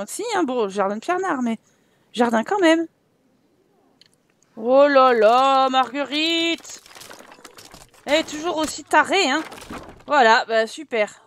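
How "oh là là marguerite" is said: exasperated call. Real, not acted. Ghost granny roams at night.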